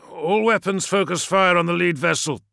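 loud, high barked order; grating command. A narrator's reading. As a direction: bark the order over the guns. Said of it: "voice grating".

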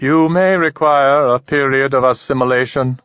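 measured alien, unhurried and odd. Acted. Alien speaks to Ramon.

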